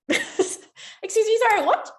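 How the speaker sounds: high, slow, stunned squeak; squeaky disbelief. Retold or imitated. Hearing the area managers' news, squeaking in disbelief. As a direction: stretch it out in total disbelief. Replaying her stunned reaction.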